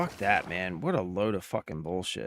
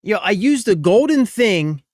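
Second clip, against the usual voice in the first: frustrated outburst; 8 semitones higher, 10 dB louder than usual. Real, not acted. Raging after dying in game.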